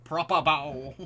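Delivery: [name] from Bo Selector. Acted. Streamer quoting a comedy show, loud parody impression. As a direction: belt it out as a parody catchphrase. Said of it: "[name] from Bo Selector".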